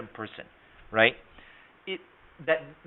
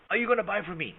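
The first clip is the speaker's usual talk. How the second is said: higher, pushy sales question "Are you gonna buy from me?"; pushy wrong version; 6 semitones higher, faster than usual. Shown as a demonstration. Salesman demonstrating the wrong close to a seminar audience, higher and pushy. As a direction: ask it like a desperate pushy seller. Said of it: "And you're not saying".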